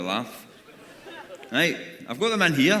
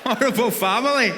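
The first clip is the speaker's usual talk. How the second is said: louder and higher, indignant repeat of "Horrible family"; mock outrage; 7 semitones higher, 5 dB louder than usual. Comedian repeats a cruel comment about his family, mock outraged. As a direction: spit "Horrible family" out like it stung.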